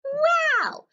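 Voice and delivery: very high, loud astonished cry; startled delighted exclamation; amazed wow. Acted. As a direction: gasp it out in wonder. Paints start singing.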